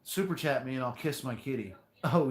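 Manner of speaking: ordinary talk, nothing marked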